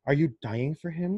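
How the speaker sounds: ordinary talk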